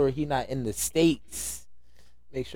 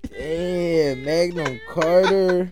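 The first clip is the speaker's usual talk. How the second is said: slow, higher and louder, squeezed out through laughter; laughing; 6 semitones higher, 6 dB louder, much slower than usual. Real, not acted. Friend cracks up at the mangled album title. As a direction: wheeze the name while cracking up.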